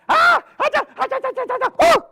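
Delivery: high squeal into rapid squirrel chatter; shriek, then jabber way up high; panicked squirrel. Acted. Squirrel threatened by the bear, high alarmed chatter.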